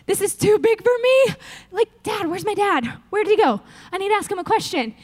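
mock panic attack. New king overwhelmed on the throne, childlike mock panic. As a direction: play him as a lost boy calling for his dad. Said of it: "he's maybe having a little panic attack".

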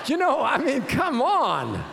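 worked-up, incredulous appeal; exasperated protest. Acted. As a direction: protest in hammed-up disbelief. Reacting to embarrassed churchgoers' excuses.

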